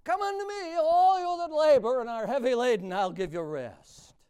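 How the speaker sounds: loud, soaring pulpit voice; preaching at full force. Acted. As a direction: proclaim the invitation with full force. Preaching a quoted verse.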